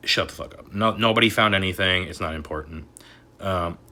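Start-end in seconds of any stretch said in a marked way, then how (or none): 0.1-0.7 unvoiced, soft aside; whispered. Real, not acted. Muttering at the XRP update article, whispered. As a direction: breathe it out under his breath.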